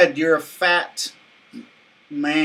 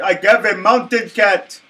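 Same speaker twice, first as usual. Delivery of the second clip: put-on Middle Eastern accent; 5 semitones higher, 5 dB louder than usual. Magician bit continues.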